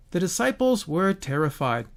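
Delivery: ordinary talk, nothing marked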